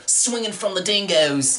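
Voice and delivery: slow mock Australian; Australian accent. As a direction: drawl it like an Aussie. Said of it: "This is an Australian movie".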